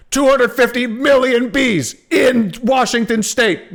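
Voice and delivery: high, loud headline read through laughter; mock news headline. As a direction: announce it like a shocked newscaster.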